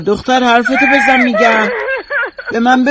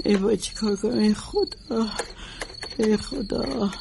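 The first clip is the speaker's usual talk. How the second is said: despairing moan; 10 dB quieter than usual. Acted. Grieving woman in shock.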